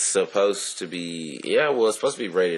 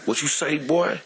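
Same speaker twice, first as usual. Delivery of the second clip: high, sharp challenge; tough confrontation; 10 semitones higher than usual. Pretend. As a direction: get in his face, mocking. Mock confrontation with racist.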